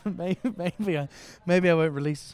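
loud, laughing, wheezy. Real, not acted. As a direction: push it out through a wheezy laugh. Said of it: "I sound so wheezy".

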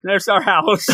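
mock cheer breaking into laughter; laughing through it. Joking about the man reaching home, laughing. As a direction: chuckle as you announce it.

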